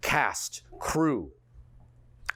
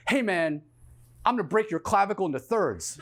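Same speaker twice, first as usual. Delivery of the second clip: mock threat; 5 semitones higher, 4 dB louder than usual. Imitating a very specific street threat.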